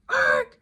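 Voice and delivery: short imitated brake squawk; tire screech noise. Car pulling up outside, imitated brake screech. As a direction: make the car's abrupt stopping sound.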